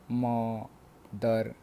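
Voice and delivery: मदर split slowly into म and दर, with a gap between; syllable by syllable. Shown as a demonstration. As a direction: say मदर as two separate, drawn-out beats. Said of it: "जिनको हम दो बार में बोलते हैं". (मदर को दो बार में तोड़कर, धीरे से)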